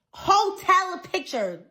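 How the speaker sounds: "Hotel picture" drawn out slowly and pitched high; incredulous emphasis. Retold or imitated. Disputing a complaint about tagging, "Hotel picture" said slowly, incredulous. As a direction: stretch "Hotel picture" high and slow in disbelief. Scornful repeat of the complained-of photo.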